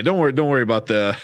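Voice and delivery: ordinary talk, nothing marked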